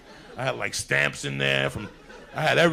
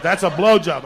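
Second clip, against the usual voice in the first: mock shout, higher and louder; 5 semitones higher, 12 dB louder than usual. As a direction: bark it back at the kid, hammed-up. Babysitter insisting in the quarrel.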